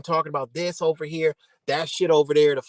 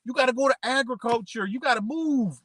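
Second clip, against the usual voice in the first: higher voice barking orders; mock officials ordering; 6 semitones higher than usual. Officials ordering people to move, hammed-up higher voice. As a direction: push them out to work the land. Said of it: "They say".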